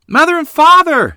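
very high, loud cry of excitement; excited shout. A narrator's reading. Girl greets returning parents, shouting in excitement. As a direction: shout it out, thrilled. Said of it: "Lottie shouted in excitement".

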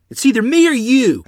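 high, loud challenge to his father; a son shouting at his abusive father; shouted threat. Acted. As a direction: yell it right in his face. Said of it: "I shouted at Afton".

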